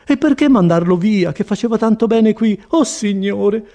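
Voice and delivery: high-pitched, dismayed complaint; distressed Agnese. A narrator's reading. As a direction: plead and lament as the upset visitor.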